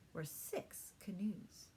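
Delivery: ordinary delivery, nothing marked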